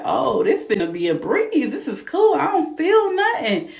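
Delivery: high-pitched, breezy and cocky; carefree confidence. Retold or imitated. Mom recalling early labor, sure it's a breeze, high and cocky. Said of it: "and I was like".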